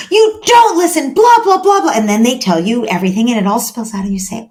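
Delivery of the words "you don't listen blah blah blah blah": high, loud mock whiny child; mock child venting. Child venting at parent, high loud mock whine. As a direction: ham up a kid spilling complaints.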